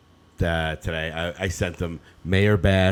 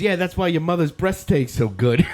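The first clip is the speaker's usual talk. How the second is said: put-on higher, louder smug kid voice; cheeky kid comeback; 9 semitones higher, 4 dB louder than usual. Retelling the TikTok, voicing the kid's cheeky comeback. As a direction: fire back like a bratty kid.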